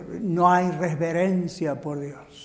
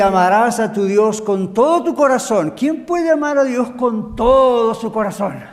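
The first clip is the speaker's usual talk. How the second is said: loud, raised preacher voice; emphatic preaching; 4 semitones higher, 6 dB louder than usual. Real, not acted. Preacher quoting Jesus to the congregation, emphatic. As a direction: challenge them with a forceful question. Preaches scripture at full force.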